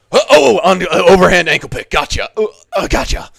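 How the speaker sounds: loud, high play-acted lunge with effort noises; mock-fight yelling in bursts; hammed-up grappling impression. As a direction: act out grabbing an ankle, strained and loud. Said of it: "impression of me ankle picking Orban Elliott".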